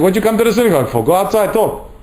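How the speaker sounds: a touch of irritated scolding in synagogue; annoyed scolding. Retold or imitated. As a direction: snap at them to take it outside.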